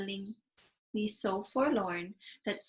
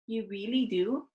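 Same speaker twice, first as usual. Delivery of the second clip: brightening; 2 semitones higher than usual. Acted. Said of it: "suddenly brightening".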